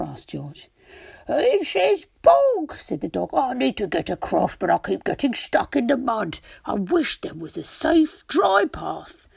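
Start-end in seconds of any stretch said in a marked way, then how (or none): 1.3-2.8 high, slow wailing dog; a high, sorrowful dog. Acted. Dog character voice. 3.3-9.3 a lightly voiced unhappy dog; a hint of a sad dog. Dog character voice.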